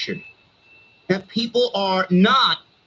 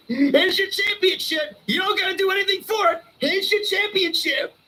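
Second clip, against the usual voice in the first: mocking rant; 10 semitones higher than usual. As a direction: sneer as you hand it over. Ranting, mock handing titles.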